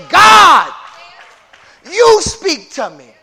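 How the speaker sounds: loud, slow cry to God; preaching shout; shouted prayer. Acted. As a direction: call out to God at full force.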